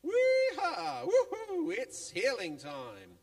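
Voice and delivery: very high, loud cheer, laughing through it; giddy squealing cheer breaking into laughter; laughing whoop. Acted. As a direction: whoop and laugh as you run. Friends cheering, running to Jesus.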